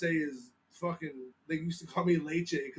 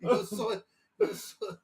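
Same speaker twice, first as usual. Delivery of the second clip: high attempt at British-accented Spanish; 5 semitones higher, slower than usual. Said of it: "start, like, in Spanish and go to British".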